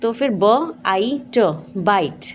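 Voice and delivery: ब, आई, ट said slowly one by one, then blended into बाइट; each letter sound of बाइट spaced out, then joined; sounding out bite. Shown as a demonstration. Teacher showing the long vowel to parents. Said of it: "आई की आवाज़ आई ही होती है".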